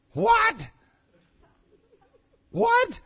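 very high, loud outraged "what"; mock shocked shriek. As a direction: imitate a wife yelping in disbelief. Wife's shocked reaction, acted.

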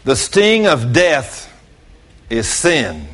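ordinary talk, nothing marked

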